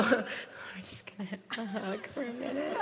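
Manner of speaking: whispered through laughter; laughing whisper. Real, not acted. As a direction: laugh softly while speaking. Laughing while offering a hug.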